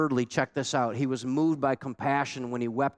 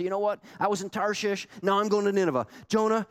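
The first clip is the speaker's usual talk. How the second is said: mock fired-up believer; 6 semitones higher, faster than usual. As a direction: play an eager convert hyping himself up. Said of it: "Jonah isn't gonna get you fired up and say".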